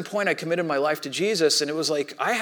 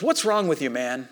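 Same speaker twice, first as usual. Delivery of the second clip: ordinary talk, nothing marked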